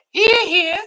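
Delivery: high, mocking hear hear; mock cheer. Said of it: "said cook".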